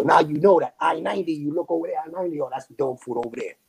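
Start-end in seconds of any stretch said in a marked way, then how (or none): none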